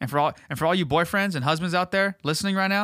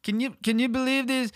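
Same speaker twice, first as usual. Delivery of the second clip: high, slow, slurred rocker voice; 5 semitones higher than usual. Retold or imitated. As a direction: ham up a dazed old rocker. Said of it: "He's like".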